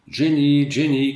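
loud call up the stairs. A narrator's reading. Uncle calling his niece upstairs, loud call. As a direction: call the niece's name loudly. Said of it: "cria Courtinet en entrant".

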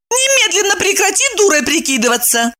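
high indignant outburst; exclaiming. A narrator's reading. Evdokia scolds her evasive friend, exclaiming high. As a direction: exclaim an order, pitched right up. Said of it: "воскликнула Евдокия".

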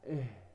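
إيه let out softly, ending in an h; isolated ha. Shown as a demonstration. Teacher demonstrating the letter ha, soft isolated h in إيه. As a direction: sound إيه low and soft on a puff from the throat. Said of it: "الهاء".

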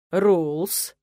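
weary, drawn-out "Рулс?" rising at the end; a worn-out rector asking; sighing, tired rising "Рулс?"; tired question. A narrator's reading. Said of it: "устало спросил Вилар".

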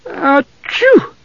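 high-pitched acted sneeze. A narrator's reading. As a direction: burst into a squeaky sneeze. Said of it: "Tas sneezed".

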